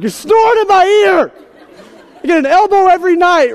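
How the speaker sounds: high, shouted exasperation; mock furious wife. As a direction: imitate a wife yelling at a snorer. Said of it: "Now she's like".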